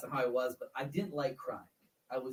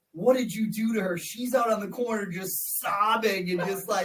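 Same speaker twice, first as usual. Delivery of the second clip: shocked coworkers; 6 semitones higher, 9 dB louder than usual. Retold or imitated. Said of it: "They're like".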